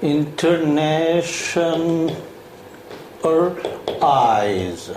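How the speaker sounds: "international." drawn out syllable by syllable, very slowly; very slow model word. Shown as a demonstration. Teacher slowly models "international." syllable by syllable for the class. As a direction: stretch every syllable of "international." out for dictation. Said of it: "어떻게 할까요?".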